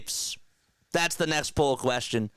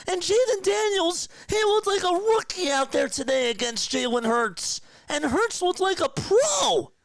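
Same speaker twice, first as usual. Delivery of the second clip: excited rant; 9 semitones higher than usual. Real, not acted. Fan raving about his team's win.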